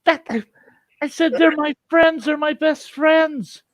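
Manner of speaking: high, desperate pleading; frantic high-pitched explaining; panicked protest. Acted. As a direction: plead your case to a cop, voice way up high. Pleads with cop in the story.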